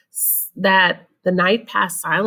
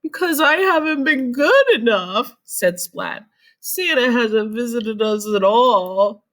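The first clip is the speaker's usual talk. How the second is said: dejected cat; 6 semitones higher, 4 dB louder than usual. Acted. Dejected Splat.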